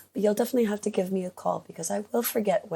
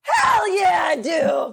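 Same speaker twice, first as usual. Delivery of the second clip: much higher, loud hyped yell; excited shout; 9 semitones higher, 6 dB louder, much slower than usual. Real, not acted. Player hyped to bump the roll. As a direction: belt it out pumped up. Player eagerly accepting challenge.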